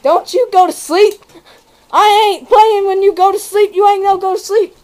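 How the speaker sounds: mock tearful robber begging; crying plea. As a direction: plead on the edge of tears. Robber pleading near tears.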